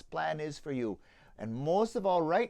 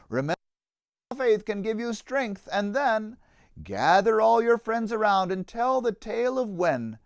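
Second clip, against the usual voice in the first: put-on lively rhyming read-aloud for children; story narration; 5 semitones higher, 9 dB louder than usual. A narrator's reading. Narrator reading a children's Easter tale, lively storytelling. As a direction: tell the tale with bounce and warmth in the rhythm.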